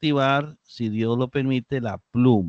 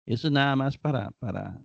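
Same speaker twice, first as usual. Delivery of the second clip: usual speaking voice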